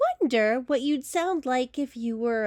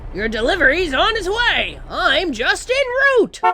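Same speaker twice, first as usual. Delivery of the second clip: higher, louder tooting truck voice; rumbling truck; 7 semitones higher, 5 dB louder than usual. Acted. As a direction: toot it like a truck. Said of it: "you'd rumble and toot".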